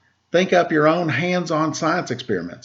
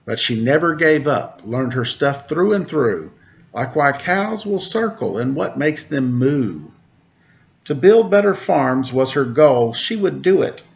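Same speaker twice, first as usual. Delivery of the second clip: put-on reading to children. Teacher reading a Temple Grandin picture book to kids, lively storybook voice. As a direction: read the rhymes aloud to a class of kids.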